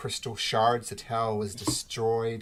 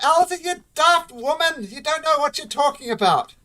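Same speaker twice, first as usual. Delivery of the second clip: put-on much higher, loud old dwarf's bellow; yelling back; 10 dB louder than usual. Old brother yelling back at his sister, loud. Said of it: "And he yells back".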